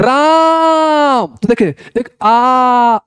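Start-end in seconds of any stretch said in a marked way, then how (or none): 0.0-1.3 राम drawn out very long and high, as if calling; calling from afar. Shown as a demonstration. Teacher calling राम to someone far off. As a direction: hold the आ in राम like hailing someone ten metres off. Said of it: "तो ऐसे बोलते हैं ना".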